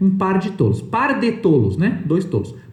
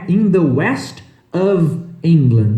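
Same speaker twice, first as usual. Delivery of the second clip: slow model phrase; slower than usual. Shown as a demonstration. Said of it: "model phrase repeated slowly".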